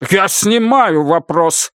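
high, drawn-out angry interruption; impotent rage. A narrator's reading. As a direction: cut in, bursting with frustration. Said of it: "его распирает от бессильной ярости".

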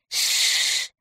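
long, soft shushing hiss; hushing "shh". A narrator's reading. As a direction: shush an accomplice softly. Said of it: "ответил крыс". (тихое протяжное шиканье)

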